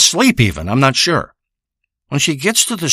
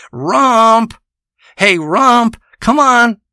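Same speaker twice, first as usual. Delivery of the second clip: calling the cat; 8 semitones higher, slower than usual. Pretend. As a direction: holler for the cat to come. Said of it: "if I call out Rump".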